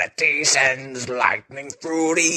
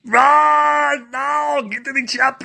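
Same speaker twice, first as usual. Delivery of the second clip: shouting; 9 semitones higher, 3 dB louder than usual. Acted. Action-hero shouted catchphrase.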